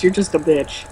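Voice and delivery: ordinary talk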